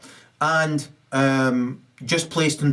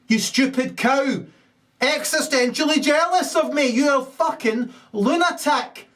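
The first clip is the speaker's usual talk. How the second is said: high furious rant; 6 semitones higher than usual. Acted. Furious high-voiced rant at rival.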